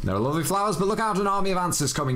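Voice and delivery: rushed reading. Shown as a demonstration. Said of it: "let's speed this up".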